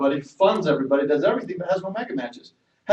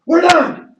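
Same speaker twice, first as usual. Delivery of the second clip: much higher, louder final verdict; furious wife; 13 semitones higher, 10 dB louder than usual. Retold or imitated. Wife shuts down husband's business, loud and final. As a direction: slam the door on it like a fed-up wife.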